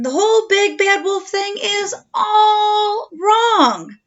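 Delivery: wolf loudly protesting his bad name; indignant wolf. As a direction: insist loudly it's all wrong.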